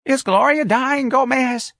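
put-on very high, slow feeble old woman; Miss Trixie. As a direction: ask it in a dotty high voice.

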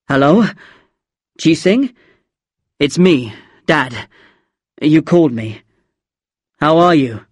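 stiff, halting father's voice; tense and unnatural. A narrator's reading. Dad phoning his child back, tense and unnatural. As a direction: speak in short, uneasy bursts. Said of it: "His voice sounded tense and unnatural".

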